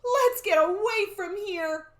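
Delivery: high, loud, stretched plea; alarmed urging. Acted. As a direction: urge escape with storybook panic. Jack urges escape.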